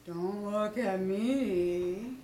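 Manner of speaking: very slow, low, mournful donkey; gloomy Eeyore. Acted. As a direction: drag each word out in misery. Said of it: "said Eeyore".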